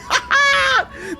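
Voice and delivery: very high laughing shriek; gleeful cackle. Real, not acted. Player gloating over his empire, gleeful cackle.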